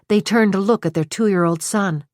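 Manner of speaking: ordinary delivery, nothing marked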